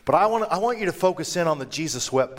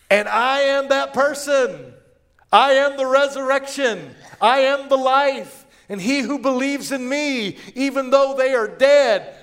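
high, loud, ringing declaration; emphatic proclamation; 10 semitones higher, 6 dB louder than usual. Acted. Jesus declaring to Martha who he is, proclaiming loudly. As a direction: proclaim it with full authority, drawing words out.

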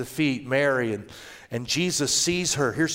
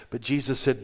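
usual speaking voice

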